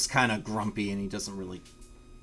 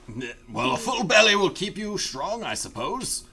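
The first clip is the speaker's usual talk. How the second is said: Umek voice; 7 semitones higher, 5 dB louder than usual. Acted. GM voicing Umek reply.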